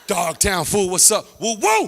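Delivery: loud gang call, rising whoops. Acted. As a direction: holler the barrio challenge, then whoop high. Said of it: "Dog Town dudes walk around the neighborhood intimidating".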